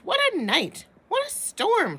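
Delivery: high chirpy little bird; twittering bird voice. Acted. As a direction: twitter it like a wren. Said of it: "twitters Wren".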